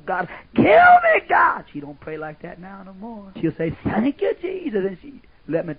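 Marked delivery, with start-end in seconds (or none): 0.1-1.6 much higher, louder wailing cry, slowed; anguished cry. Retold or imitated. Preacher voicing a sister's night prayer, a wailing cry. 3.8-4.8 praising God. Retold or imitated. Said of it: "You'll hear that woman praising God".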